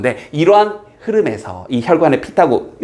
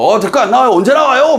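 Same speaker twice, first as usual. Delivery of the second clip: high, restless mock complaint; impatient customer; 7 semitones higher, 4 dB louder, faster than usual. Teacher acts diner whose order is late, impatient mock complaint. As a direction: press the waiter impatiently, pitched up. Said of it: "오 분만 넘어도 막 들썩들썩 하면서".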